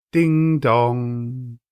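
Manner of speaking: ding dong rung out loud and long like a clock bell; chiming bell. A narrator's reading. Narrator ringing out the clock chime, loud and sustained. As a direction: sound it like the tolling bell.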